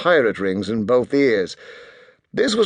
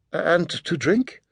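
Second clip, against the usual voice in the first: timid waitress voice; 7 semitones higher, slower than usual. Acted. Waitress voiced, high, slow.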